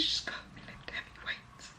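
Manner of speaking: a secret shared with the viewers; whisper. Real, not acted. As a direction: lean in and hush the plea. Repeats the plea in a whisper.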